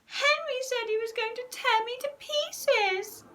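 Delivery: put-on high whining little boy voice; whiny tattling child. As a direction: whine like a telltale brat. Peter tattles to Mum.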